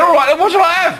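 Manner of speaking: high, loud exasperated plea. Acted. Customer fed up with the genie's endless questions, exasperated. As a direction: throw up your hands at the fussy genie.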